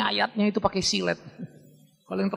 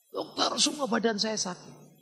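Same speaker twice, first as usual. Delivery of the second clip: complaining patient; 3 semitones higher, 6 dB quieter, slower than usual. Retold or imitated. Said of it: "orang Beduin, dia pergi berobat".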